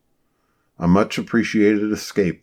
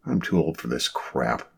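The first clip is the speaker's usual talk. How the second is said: softer, lower tired aside; muttered to himself; 5 semitones lower, 7 dB quieter than usual. A narrator's reading. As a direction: grumble it under your breath. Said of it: "he convinced himself".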